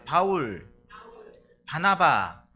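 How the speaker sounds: names called loud for the congregation to repeat. Shown as a demonstration. Preacher leading the congregation to repeat Bible names, loud clear calls. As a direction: call each name so they echo it. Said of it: "따라해보세요".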